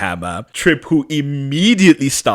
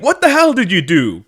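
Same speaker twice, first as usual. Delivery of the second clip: higher, louder accusing shout; retold angry demand; 9 semitones higher, 6 dB louder than usual. Matt turning on Stefan, a mock angry shout. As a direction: accuse him hard. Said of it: "turns to Stefan and is like".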